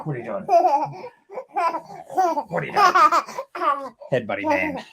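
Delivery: high squeaky laugh through the words; real giggling while asking. Real, not acted. Dad laughing at kid's headbutt, high and giggling.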